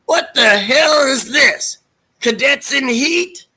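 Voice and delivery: high, slow, mock-outraged drill sergeant; Harris character voice. Retelling Police Academy to a friend, high slow mock-outraged Harris. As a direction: sneer it out slowly like a pompous officer. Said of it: "he's like".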